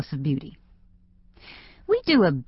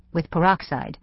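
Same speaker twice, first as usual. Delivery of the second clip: usual speaking voice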